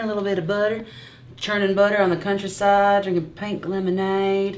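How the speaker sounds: dream of the countryside in a mock Southern accent; playful Southern accent. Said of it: "I wanted to be Southern".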